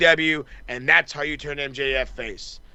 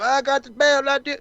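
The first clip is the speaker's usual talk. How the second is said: high mock showboating; cocky strutting impression in a high voice; Ricochet; 10 semitones higher than usual. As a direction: brag like a hot-dogging wrestler, pitched way up. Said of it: "Ricochet's hot dogging his way to the ring".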